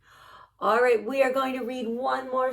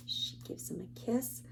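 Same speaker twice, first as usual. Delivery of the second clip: whisper; 12 dB quieter than usual. Acted. Tender bedtime kiss moment.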